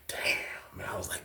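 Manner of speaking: hushed whisper under his breath. Retold or imitated. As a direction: whisper it so nobody hears. Aside while Banks yells.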